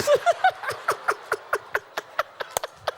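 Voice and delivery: breathy high-pitched giggle; high laugh. Real, not acted. Preacher cracking up at his own story, high laugh. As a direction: burst into a helpless laugh.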